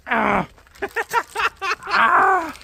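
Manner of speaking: ordinary talk, nothing marked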